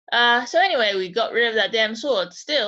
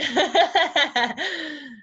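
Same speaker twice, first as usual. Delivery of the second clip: high chuckle from the talking sword; sword's laugh; 6 semitones higher than usual. Acted. Sword laughs at its new owners, a high chuckle. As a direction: let the blade laugh at them. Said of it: "the sword is going to start talking".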